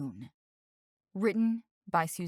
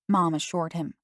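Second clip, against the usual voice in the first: usual speaking voice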